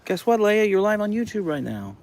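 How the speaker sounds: high, sing-song voice for the dog; high pet talk. Acted. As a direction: talk up high like cooing at a puppy. Talking to the dog Leia.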